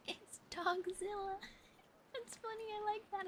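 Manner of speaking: high-pitched, laughing delight; amused. Real, not acted. Streamer tickled by Dogzilla, laughing.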